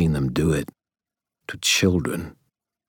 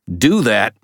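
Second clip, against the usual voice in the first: raised, louder threatening call; 5 semitones higher, 6 dB louder than usual. A narrator's reading. Ebenezer warning the crew at the ropes, calling out loudly. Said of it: "Ebenezer called".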